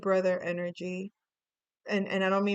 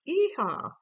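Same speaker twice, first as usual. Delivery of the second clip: high, loud whoop; excited yeehaw; 7 semitones higher, 4 dB louder than usual. Real, not acted. Tarot reader cheering a card, excited whoop. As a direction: let out a quick cowgirl yeehaw. Spontaneous whoop while reading cards.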